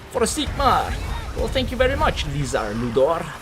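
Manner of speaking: high-pitched silly put-on voice; weird comic voice. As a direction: thank a viewer in a goofy, high voice. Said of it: "why I keep talking like that".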